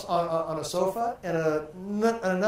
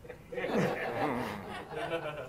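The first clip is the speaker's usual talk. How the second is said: laughing; 3 semitones lower, 10 dB quieter than usual. Real, not acted. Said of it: "laughs at the joke".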